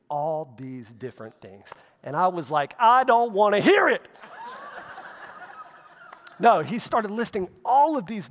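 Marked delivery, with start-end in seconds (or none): 2.8-4.1 mock petulant refusal. Jokingly refusing God's list. 4.2-5.6 short, very high giggle; a quick amused squeal of a laugh; high squeaky laugh. Real, not acted. Laughs at own joke.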